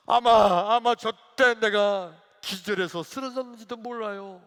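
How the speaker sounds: loud, high acted lament; agitated outcry. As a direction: shout out the worst memory to Jesus. Acted deacon reliving worst moment. (크고 높게 울부짖듯)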